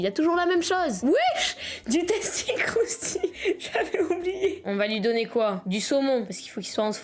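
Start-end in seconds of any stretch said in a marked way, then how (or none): 1.0-1.6 high-pitched surprised "wesh"; surprised yelp. Real, not acted. Surprised exclamation at the game. 1.9-4.6 slow, higher, through laughter; can't keep a straight face; laughing. Real, not acted. Cracks up at a silly phrase.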